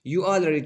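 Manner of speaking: "You already" starting high and loud, then falling; falling intonation. Shown as a demonstration. Teacher showing exclamation intonation, falling tune on "You already". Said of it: "falling".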